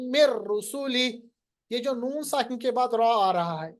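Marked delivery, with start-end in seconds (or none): 0.1-1.2 very slow merged recitation; Quran recitation. Shown as a demonstration. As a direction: chant it slowly, joining the n into r. Teacher recites Quran words.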